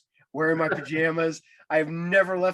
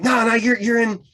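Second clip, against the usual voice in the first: loud, high, excited insistence; insistent fan; 7 semitones higher, 9 dB louder than usual. Retold or imitated. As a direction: cut in loudly, refusing to believe the denial. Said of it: "this guy would not leave me alone".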